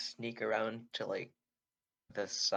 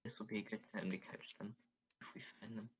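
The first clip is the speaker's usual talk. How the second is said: whispering; 10 dB quieter than usual. Acted. Player plots an ambush with the party, whispering. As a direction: keep it down, barely voiced. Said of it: "trying to whisper and stuff".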